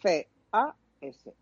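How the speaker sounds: "P O D C A S" spelled slowly, letter by letter; spelling slowly. Shown as a demonstration. Spelling the Spanish form for listeners, slow and deliberate. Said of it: "empiece a llamarse esto podcast".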